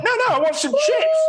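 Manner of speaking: high, squeaky mock insistence; insistent. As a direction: plead it like a baffled tourist. Insisting on chips, retold.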